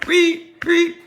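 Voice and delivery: high-pitched mock screech of the shower strings; Psycho violin screech. Mimicking the shower-scene music in his head, high screech.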